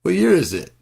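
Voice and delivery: high, loud, panicked question; frantic high question. Acted. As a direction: demand it like a time traveler. Asking neighbor kid the year.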